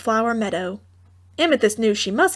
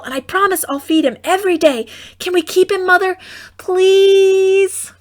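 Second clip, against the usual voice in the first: high, louder whining plea; whiny girl; 6 semitones higher, 4 dB louder than usual. A narrator's reading. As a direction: beg like a spoiled child. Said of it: "switched to a whiny sort of voice".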